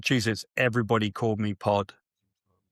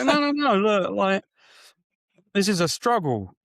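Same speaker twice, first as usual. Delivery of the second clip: frantic pleading correction; exasperated; 8 semitones higher than usual. Retold or imitated. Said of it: "And I go".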